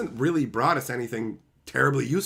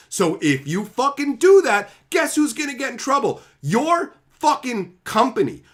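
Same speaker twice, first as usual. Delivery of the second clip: angry rant; 8 semitones higher, 5 dB louder than usual. Real, not acted. Furious rant at the company.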